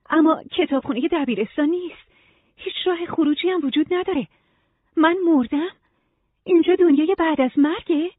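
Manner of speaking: high-pitched, anxious questioning; bewildered Nora. Acted. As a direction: voice a confused woman demanding answers.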